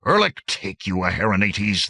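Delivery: captain cursing his lieutenant; grated curse. Acted. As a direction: grind the words out in anger. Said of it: "the captain grated".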